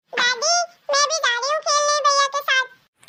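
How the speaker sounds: put-on high, eager little girl asking to tag along; a small girl pleading to go play with her brother. Little Pinki asks grandma to let her play, high childlike voice.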